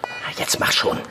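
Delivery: hushed, impatient whisper; whispered urging. Acted. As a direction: hurry her along under your breath. Heimlich im Krankenhaus drängen. (ungeduldiges Flüstern)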